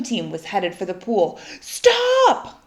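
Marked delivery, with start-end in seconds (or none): 1.6-2.6 high, loud cry to halt them; shouted stop. Acted. Lewis shouts at the swim team, high and loud. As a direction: yell it to stop the swimmers. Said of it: "cried Lewis".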